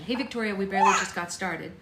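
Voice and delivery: usual speaking voice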